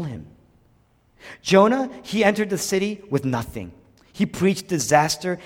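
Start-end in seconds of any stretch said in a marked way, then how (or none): none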